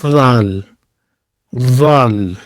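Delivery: غال said as dhal, a voiced th from the teeth edge; Arabic dhal demo. Shown as a demonstration. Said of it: "جیسے English میں آپ T H".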